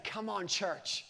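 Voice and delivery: put-on soft, slow little kid's call; a hushed childlike nudge; gentle plea. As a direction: coax the church quietly. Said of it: "rise up and say".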